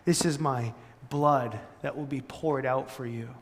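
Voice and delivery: soft, tender retelling of Jesus' words; gently, as if handing it to friends; confident and loving. Retold or imitated. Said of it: "saying it confidently and lovingly".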